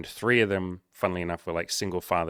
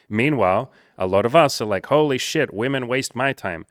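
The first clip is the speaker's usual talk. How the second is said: louder, higher, worked-up urging; fired-up rant; 4 semitones higher, 6 dB louder than usual. Real, not acted. Host lecturing men about wasting women's time, fired-up and loud. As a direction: push the men hard, get heated.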